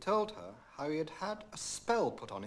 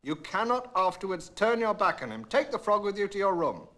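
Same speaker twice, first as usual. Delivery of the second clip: louder, higher stern father; stern king; 7 semitones higher, 7 dB louder than usual. A narrator's reading. Angry king scolding his daughter, stern and loud. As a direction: lay down the law firmly. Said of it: "grew angry and spoke sternly".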